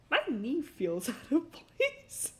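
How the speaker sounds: slow words broken by laughter; laughing through it. Retold or imitated. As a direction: laugh while noticing the knee. Realizing injury, laughing.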